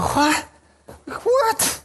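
high startled double take; shocked disbelief. Retold or imitated. Man stunned God's verse rebukes him, high shocked cry. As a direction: squeak it out, caught off guard.

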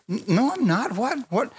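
high, startled denial; shocked protest. Retold or imitated. Girl protesting to the church woman, shocked and high. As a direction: protest in disbelief.

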